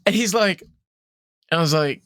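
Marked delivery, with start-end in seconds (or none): none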